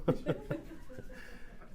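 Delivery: airy breathy chuckle; soft laugh. Real, not acted. Father laughing about his daughter, airy chuckle.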